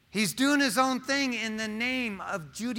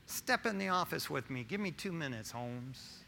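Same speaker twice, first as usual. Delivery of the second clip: soft, low mock menace; mock threat; 3 semitones lower, 8 dB quieter than usual. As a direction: drop low and quiet like a joking threat. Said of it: "No, I'm just kidding".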